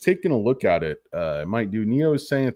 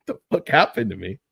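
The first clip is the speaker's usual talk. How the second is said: ordinary delivery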